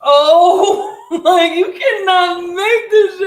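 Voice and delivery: high, shrieking laughter through the words; cry-laugh it out in disbelief. Real, not acted. Hearing the ruined contract story.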